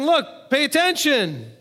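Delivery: high, slow call to the crowd. Retold or imitated. Yeshua calling to a big crowd to pay attention. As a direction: raise it to reach a crowd. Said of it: "talking to a big crowd".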